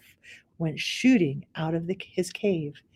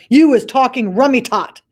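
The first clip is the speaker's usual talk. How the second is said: loud, higher bolder giant; braver BFG; 6 semitones higher, 8 dB louder than usual. Acted. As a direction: stand up to the bully.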